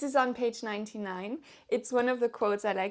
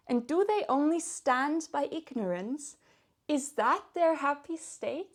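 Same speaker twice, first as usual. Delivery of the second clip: dramatic poem reading; 3 semitones higher than usual. Acted. Vlogger reading the devil's lines to viewers, dramatic recitation. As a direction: savour each line as the devil's musing. Reads Milton quote aloud.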